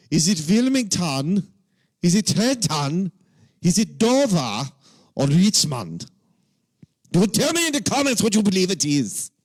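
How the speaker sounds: put-on German accent, emphatic and demanding; mock German accent. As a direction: play a comic German interrogator, high and insistent. Puts on accent after misreading Delaware.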